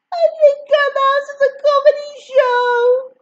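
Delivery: crying. A narrator's reading. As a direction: sob the words out. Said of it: "I start crying again".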